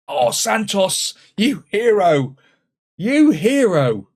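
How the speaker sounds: loud, slow cheer for the hero; pure relief and delight; jubilant. Real, not acted. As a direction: praise him at full volume. Keeper wins the shootout.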